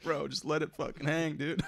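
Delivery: quoting the coach through laughter; laughing coach quote. Retold or imitated. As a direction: laugh while you give the coach's advice. Quoting bullpen coach while laughing.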